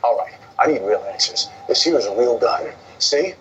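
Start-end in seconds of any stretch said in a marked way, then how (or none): none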